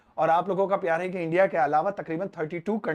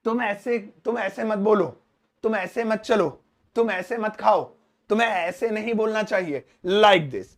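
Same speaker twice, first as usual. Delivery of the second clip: usual speaking voice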